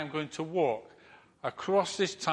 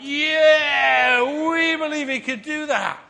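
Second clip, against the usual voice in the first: much higher, louder excited yell; crowd cheer; 11 semitones higher, 10 dB louder, slower than usual. Retold or imitated. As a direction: cheer like a stadium. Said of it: "they all went".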